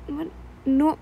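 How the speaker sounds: ordinary delivery, nothing marked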